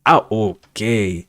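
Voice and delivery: ordinary delivery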